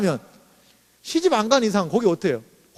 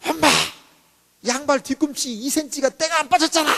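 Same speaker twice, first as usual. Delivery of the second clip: high, mock-irritated daughter's complaint; prickly teen whining; 6 semitones higher than usual. High school senior complaining to mom about dirty socks, prickly and petulant. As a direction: act out a touchy teenager nagging. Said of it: "어느 정도 까칠하냐면 극세사 까칠이에요".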